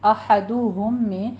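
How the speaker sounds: احدہم said very slowly with rounded lips on the damma. Shown as a demonstration. Teacher modelling احدہم so students keep the damma. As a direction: stretch احدہم and keep the u sound clear. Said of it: "اس لیے دھیان دیجیے".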